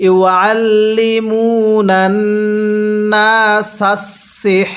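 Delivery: slow chanted ইউআল্লিমুনান, long "moo"; elongated mim. Shown as a demonstration. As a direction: stretch the vowel after mim, keep level. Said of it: "এক আলিফ টানতে হবে". (মিমে এক আলিফ টেনে তিলাওয়াত)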